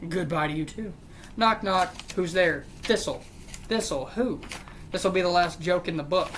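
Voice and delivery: ordinary talk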